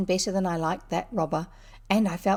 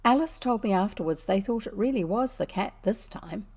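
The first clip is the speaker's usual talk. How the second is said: ordinary delivery, nothing marked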